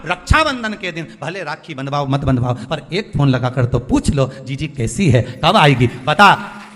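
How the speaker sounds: loud, high preacher's appeal; impassioned preaching. Acted. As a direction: urge the congregation with full force. Preaches at full force.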